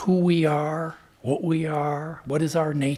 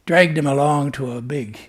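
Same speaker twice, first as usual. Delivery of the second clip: ordinary talk, nothing marked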